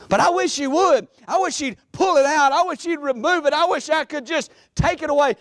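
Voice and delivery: high, insistent acted wish; pleading complaint. Preacher voicing people upset with God, high pleading. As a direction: pile up the wishes with mounting urgency. Acts out believers pleading for removal.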